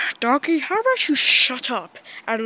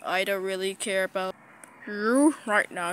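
ordinary talk, nothing marked

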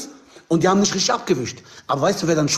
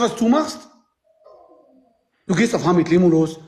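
ordinary talk, nothing marked

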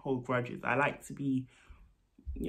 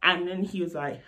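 usual speaking voice

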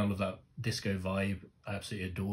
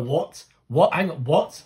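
loud, higher, repeated shocked what; stunned disbelief; 7 semitones higher, 11 dB louder, slower than usual. Retold or imitated. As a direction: replay the moment the news hit. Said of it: "I was like".

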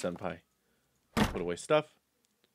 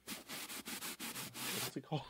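whisper, slow and unsure; hushed self-question; whispered aside; 20 dB quieter, much slower than usual. Real, not acted. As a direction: mutter the question under breath. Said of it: "muttering to self".

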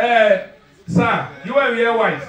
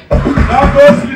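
loud, slow, high shout; hammed-up shouted order; 7 semitones higher, 10 dB louder, much slower than usual. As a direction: drag out the yell.

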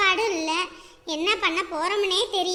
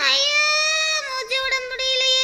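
wailing shriek; 5 semitones higher than usual. Acted. Trapped patient crying for help, wailing shrilly. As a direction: cry out as if suffocating. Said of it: "wheel வீலுன்னு கத்திக்கிட்டு கிடக்குறா".

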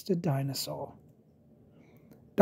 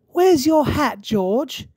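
Mummy Pig voice; 9 semitones higher, 4 dB louder, slower than usual. Acted.

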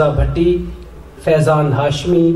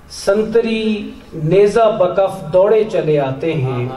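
raised-pitch, measured mushaira declamation; ghazal recitation; 4 semitones higher than usual. Acted. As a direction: declaim each line to the hall, letting it fall at the close.

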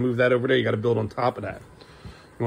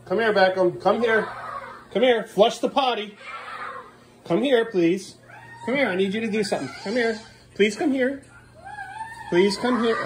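high sing-song calling for his son; 9 semitones higher than usual. Real, not acted. Dad calling son over to flush, high sing-song. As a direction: coax him over, up high.

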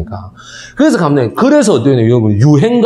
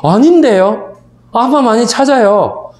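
teacher insisting; 7 semitones higher, slower than usual. Retold or imitated. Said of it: "그러니까".

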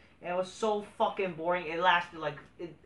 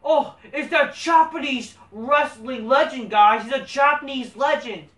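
sarcastic impression of hype-driven fans; mocking fanboy voice; 6 semitones higher, 8 dB louder than usual. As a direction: parody the fans hyping a wrestler nobody knows. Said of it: "it's this".

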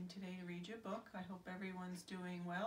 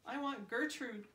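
higher and louder, a toy's pleading cry; 6 semitones higher, 6 dB louder than usual. Acted. Duck cries for Gertrude in the dark scrapyard, pleading. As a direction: cry for her like a lost little one. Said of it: "cried Duck".

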